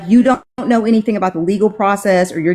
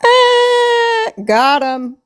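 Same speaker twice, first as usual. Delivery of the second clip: gleeful squeal; much slower than usual. Real, not acted. Cheering the winning comeback.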